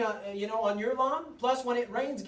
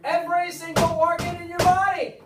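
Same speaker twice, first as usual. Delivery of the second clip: much louder and higher, hammering it home; loud emphatic repeat; 7 semitones higher, 11 dB louder than usual. Real, not acted. Speaker to audience, repeating the point in a loud emphatic voice. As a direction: drive every word to the back row.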